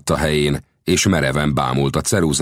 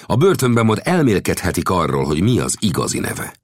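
ordinary delivery

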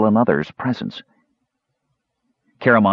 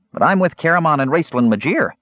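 high, self-important kender; 9 semitones higher than usual. A narrator's reading. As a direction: puff up proudly. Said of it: "said the Kender importantly".

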